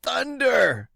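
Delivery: put-on old seaman crying out in alarm; cried out. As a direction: bellow it in shock. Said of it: "he cried".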